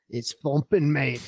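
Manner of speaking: imitated proper Scottish accent. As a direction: put on a Scot's accent, a little livelier. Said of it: "in, like, that proper Scottish accent".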